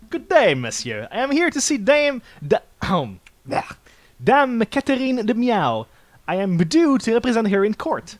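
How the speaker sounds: formal introduction to a guard; polite lawyer. Acted. Voices Falcon the lawyer.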